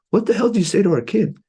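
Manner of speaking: high-pitched mock-outraged parent; retold parental astonishment. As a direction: imitate a stunned parent demanding an explanation. Parents' astonished phone call retold.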